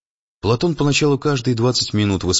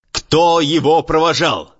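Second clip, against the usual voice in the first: furious bellow, louder and higher; raging; 8 semitones higher, 5 dB louder, slower than usual. A narrator's reading. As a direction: roar the question in a rage. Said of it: "бушевал Платон".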